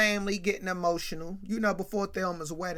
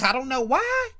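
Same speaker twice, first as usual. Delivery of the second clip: mock-indignant, louder and higher; 8 semitones higher, 8 dB louder than usual. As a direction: act out an affronted big shot. Sweet Daddy miffed at being uninvited.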